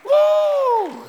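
high, loud joyful whoop. Real, not acted. Praise shout at climax.

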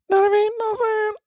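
very high squeaky voice; squeaky falsetto. Pretend. Squeaky repeated tag question.

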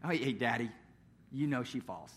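put-on low, hushed excuse from a child; guilty little boy. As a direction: play a kid quietly covering up. Son's guilty answer, acted.

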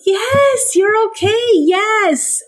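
high-pitched, eager puppy praise; upbeat reassurance. Acted. Owner to unsure puppy, upbeat reassurance. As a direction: coax the unsure pup along, pitched well up.

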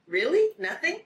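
high, rising incredulous question; REALLY and NOTHING both pitched high as questions; retelling a startled double-check; retold disbelief. Retells her disbelieving question to him.

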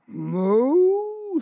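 high, loud mooing; cow moo. Acted. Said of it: "said the cow".

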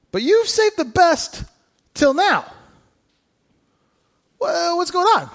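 high, slow astonished praise; high incredulous banquet master; amazed guest. Retold or imitated. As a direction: marvel at the good wine. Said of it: "called the bridegroom inside and said".

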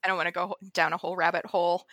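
ordinary talk

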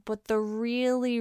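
usual speaking voice

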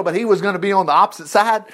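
ordinary talk

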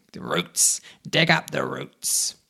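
loud, furious cat command; angry shout. A narrator's reading. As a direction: shout the order in rage. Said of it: "the big cat got very angry, and it shouted".

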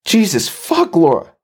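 high, startled wail; high-pitched yelp of alarm; surprised wail. A narrator's reading. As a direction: cry out high in shock. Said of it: "Jesse wailed in surprise".

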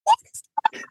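extremely high mock squeal; re-enacted squeal of disbelief; overwhelmed disbelief. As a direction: squeak it out as if stunned. Overwhelmed reaction to the guest's praise.

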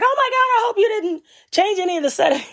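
high, fast, laughing mock panic; mock screaming agent. Agent freaks out that caller changed settings, high laughing panic. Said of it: "kept popping off and screaming at me".

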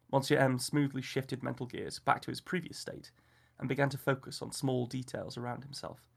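ordinary delivery, nothing marked

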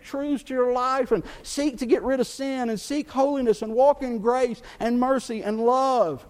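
loud, high preaching build; fervent full-voiced sermon push. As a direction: drive it home to the congregation.

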